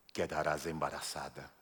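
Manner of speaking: very low, very soft, flat droning angel; spooky angel voice. Pretend. Angel comforting María, eerie deep drone. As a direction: make the comfort sound frightening.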